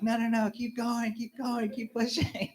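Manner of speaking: high-pitched urging, retold; urgent coaching. Dad urges stuck son downward, acted-out urgent encouragement. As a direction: act out a dad cheering his kid on.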